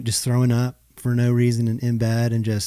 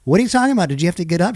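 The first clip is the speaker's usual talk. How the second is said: higher, mock-indignant wife voice; exasperated wife; 6 semitones higher, 4 dB louder, much faster than usual. Husband imitating his wife's exasperated complaint. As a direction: play the fed-up wife.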